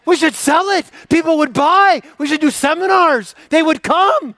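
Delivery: high, loud mock-excited sarcasm; sarcastic glee. Mocking the useless advice, loud sarcastic cheer. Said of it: "You're like".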